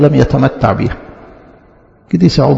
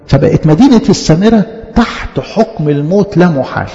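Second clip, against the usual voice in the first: ordinary talk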